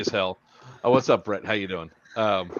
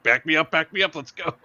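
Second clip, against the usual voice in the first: higher-pitched call to reverse; urgent retold call; 6 semitones higher than usual. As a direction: call it out urgently, reliving the moment. Retelling urgent call to driver.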